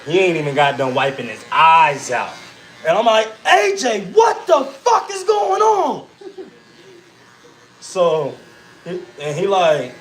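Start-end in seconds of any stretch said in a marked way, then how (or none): 3.5-6.1 high, drawn-out mock shout; retold angry yelling. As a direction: act out yelling at your son. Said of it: "I was yelling at him a bit".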